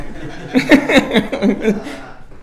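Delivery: breathy high laugh; a burst of laughter; real laugh. Real, not acted. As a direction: laugh out at the joke. Laughs at his own imitation.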